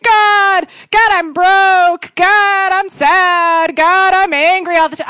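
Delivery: high, loud, pleading cries to God; mock desperate prayer. A worshipper crying out problems to God, high loud mock pleading.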